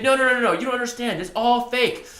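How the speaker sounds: high-pitched imitated objector; mock insistent protest. Imitated moviegoer arguing with the teller, high mock insistence. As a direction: rattle off the denial in a high put-on voice.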